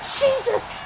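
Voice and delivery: high, laughing exclamation; laughing shock. Real, not acted. Commentator laughing in disbelief at pole-vault leg drop.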